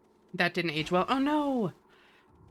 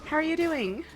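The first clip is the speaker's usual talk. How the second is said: excited greeting; 10 semitones higher than usual. Real, not acted. Thanking a new follower.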